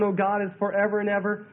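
ordinary talk, nothing marked

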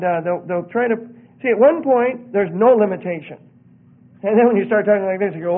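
ordinary delivery

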